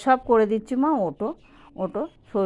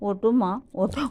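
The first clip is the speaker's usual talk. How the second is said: usual speaking voice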